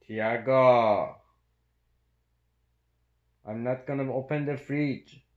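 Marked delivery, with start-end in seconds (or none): 0.1-1.2 shouting. Real, not acted. Calls out to dog.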